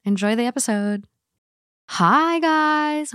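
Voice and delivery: normal talking voice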